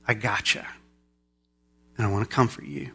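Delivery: low, hushed, comforting voice; tender reassurance. Acted. Loving God to messy, broken people, tender. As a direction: soothe them softly and low.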